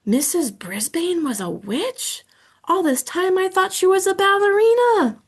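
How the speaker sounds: hamster amazed. Acted. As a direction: squeak it out in amazement.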